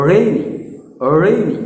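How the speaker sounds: রেইনি opening on a rounded, curled r; emphatic রেইনি with gliding ei; rolled-tongue English r. Shown as a demonstration. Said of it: "জিভটাকে তালুর ওপরে ঠেকিয়ে".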